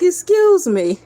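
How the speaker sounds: high, loud call for attention; loud polite call. Retold or imitated. Addressing the vampires.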